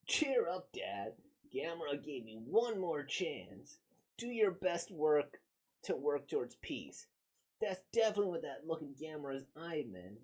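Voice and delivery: lightly voiced, hopeful young boy; a hint of an encouraging child; kid voice. Kid cheering up his father.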